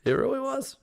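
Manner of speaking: high, bright excited agreement. Real, not acted. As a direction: gush that it really was. Agreeing excitedly about Martinsville.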